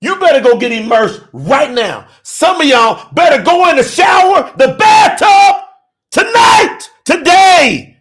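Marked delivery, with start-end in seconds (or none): 2.2-8.0 high, loud, rising preacher's cry; preaching shout. Real, not acted. Preacher urging his listeners to get baptized tonight, loud preaching shout. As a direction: call them to the water at full force.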